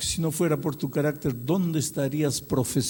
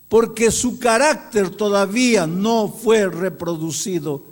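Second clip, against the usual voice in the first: loud, high, impassioned sermon delivery; ringing pulpit proclamation; full-force preaching; 9 semitones higher, 8 dB louder than usual. Acted. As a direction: preach it out to the whole church.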